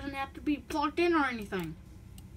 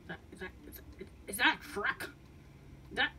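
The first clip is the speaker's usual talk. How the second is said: ordinary talk, nothing marked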